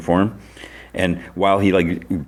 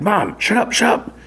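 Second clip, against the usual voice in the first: very high imitation of a mortified son; retold outburst, pitch shooting up, no real shout; mock flustered protest. Son begging mom to stop embarrassing him, flustered imitation.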